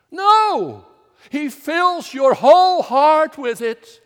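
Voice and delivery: a sudden loud, high No!; emphatic no. Real, not acted. Preacher rejecting his questions to the congregation, loud and high. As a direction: burst out with the answer.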